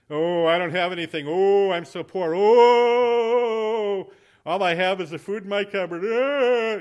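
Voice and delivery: loud, high hammed-up moaning; mock poor-me wailing. Preacher mimics locals with a poverty mentality, mock poor-me wail. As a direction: wail it out in exaggerated self-pity, drawing out the ohs. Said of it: "We have a poverty mentality".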